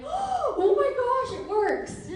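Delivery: squealing high with delight, drawn out; overjoyed slow cry of delight; thrilled gasp. Retold or imitated. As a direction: gush like it just worked, laughing. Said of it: "I'm like".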